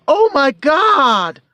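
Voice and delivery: high, slow, loud mock cry of shock; high drawn-out gasp of Oh, my God; lady exclaiming. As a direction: play the shocked lady crying out at the sight. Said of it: "she literally exclaimed".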